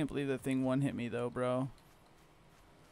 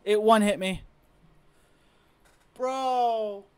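outraged; 7 semitones higher, 8 dB louder, slower than usual. Real, not acted. Complains about being killed.

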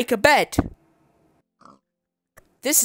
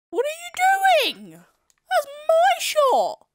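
squeaky whine; slower than usual. Pretend. Squeaky cry at villager.

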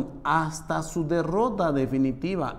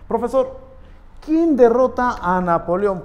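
higher, louder eager student voice; pupil asking teacher; 7 semitones higher, 7 dB louder than usual. Pretend. Teacher mimics a pupil's eager question to the class, higher and louder. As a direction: pipe up like a curious schoolkid.

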